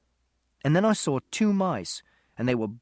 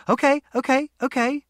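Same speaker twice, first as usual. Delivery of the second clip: soothing; 10 semitones higher, 5 dB louder than usual. Acted. Lady calming the boy who screamed at her touch, soothing. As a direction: back off gently to calm him.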